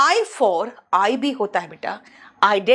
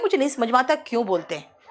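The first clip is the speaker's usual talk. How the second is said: ordinary talk, nothing marked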